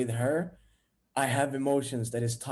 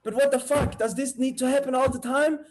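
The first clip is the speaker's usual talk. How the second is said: high, loud exasperated outburst; angry; 9 semitones higher, 6 dB louder than usual. Acted. Boyfriend to girlfriend about admirers, angry outburst. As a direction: act out heated jealous complaint. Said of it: "I'm starting to become angry at her".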